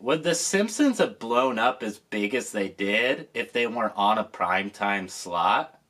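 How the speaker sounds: ordinary talk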